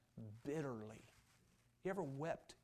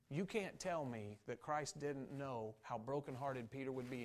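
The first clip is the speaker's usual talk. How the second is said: normal talking voice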